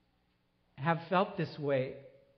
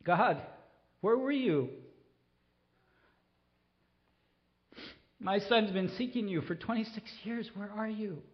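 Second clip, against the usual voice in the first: high, pleading lament to God; a father's raw plea, voice pitched up; anguished cry; 4 semitones higher than usual. Retold or imitated. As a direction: cry out to God for your son. Father lamenting son's lost faith.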